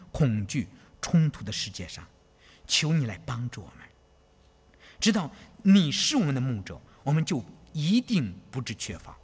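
earnest, fervent prayer voice; closing prayer. Acted. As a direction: pray aloud for the congregation.

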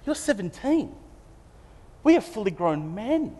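older brothers mocking Joseph's dream of ruling them; scornful brothers. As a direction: put the little upstart in his place.

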